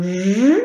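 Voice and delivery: j as a long held zh sound; isolated first sound. Shown as a demonstration. Teacher sounding out jon for pupils, drawn-out j. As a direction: sustain j as a buzzing "zhhh", not the letter name. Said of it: "j'entends d'abord le".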